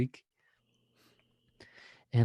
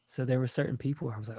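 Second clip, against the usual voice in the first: normal talking voice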